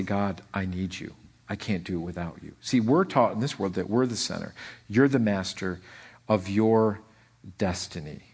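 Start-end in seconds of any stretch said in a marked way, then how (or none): 0.0-2.6 lower, softer pleading to God; humble prayer. Acted. Believer comes to God admitting need, quietly humble. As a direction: drop into a quiet, humble admission.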